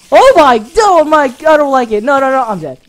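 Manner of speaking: loud, high frantic whine; frantic dread. Real, not acted. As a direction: panic as they close in. Spiders overwhelm him.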